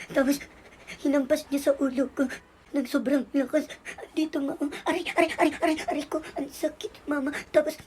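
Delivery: put-on child sobbing out a complaint; acted crying, words broken by gasps. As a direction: tell on someone through hiccuping sobs. Child tearfully reports on brother.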